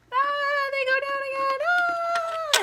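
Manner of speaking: very high, loud sung-out whee; singsong thrill. Acted. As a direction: sing it out like riding the sled. Sledding down, singsong cries.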